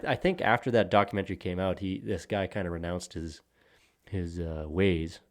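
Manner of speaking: ordinary talk, nothing marked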